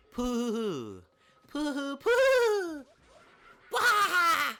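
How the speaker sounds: high squealing bear laugh, hammed up; Monokuma cackle. Monokuma mocks students with a squealing bear laugh. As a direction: cackle mockingly in a shrill mascot voice. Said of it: "Why are you laughing?".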